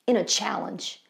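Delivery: usual speaking voice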